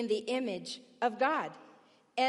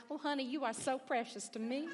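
put-on Southern accent; 2 semitones higher, 5 dB quieter than usual. Speaker shows the church how "precious" should sound, Southern accent. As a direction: gush it softly with a Southern accent. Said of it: "gotta be said with a Southern accent".

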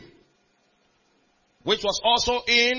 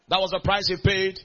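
ordinary delivery

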